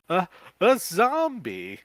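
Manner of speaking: high, loud, slow frightened stammer; stammering in fear at the zombie. Acted. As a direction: stumble over it in terror. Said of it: "you stammer".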